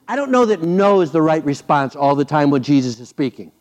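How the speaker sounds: normal talking voice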